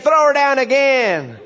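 high, loud country-preacher holler; hammed-up bellowed command, drawn out; acted shout. Man ordering Jezebel thrown down again, mock-dramatic shout. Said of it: "And he said".

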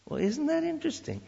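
high, amazed question; amazed wonder. Real, not acted. Marvelling at mutual benefit.

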